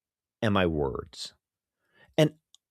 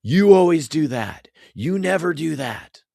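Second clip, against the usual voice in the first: higher, louder accusing jab; raised-voice finger-pointing quote; mock accusation; 5 semitones higher, 5 dB louder than usual. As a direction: act out an accusing complaint. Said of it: "accusing language".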